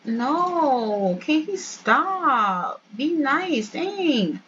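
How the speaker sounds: half-laughing scold at the game; exasperated scolding. Real, not acted. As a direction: drag out "can he stop" slowly, then snap "dang". Scolding her game character.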